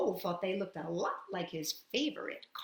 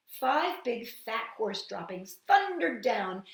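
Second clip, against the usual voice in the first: ordinary delivery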